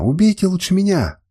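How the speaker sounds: high, desperate narrated wail; wailing plea. A narrator's reading. Woman begs to die in her lover's place, wailing. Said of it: "причитала она".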